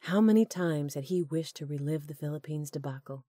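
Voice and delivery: plain reading voice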